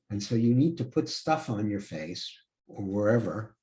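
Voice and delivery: usual speaking voice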